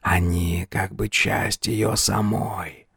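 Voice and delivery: slow, soft ghostly voice; hushed, drawn-out spectral voice; ghost of a dead king. A narrator's reading. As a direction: speak slowly and softly, like a shade. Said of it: "пояснила тень Типицимона двадцать седьмого".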